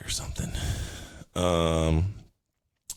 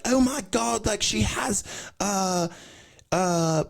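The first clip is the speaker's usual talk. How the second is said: high-pitched mock alarm; put-on band frontman. Band announcing a scan result onstage, high comic shock. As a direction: gasp the news in a high, silly voice.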